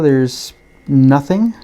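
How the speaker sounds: usual speaking voice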